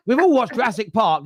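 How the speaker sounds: usual speaking voice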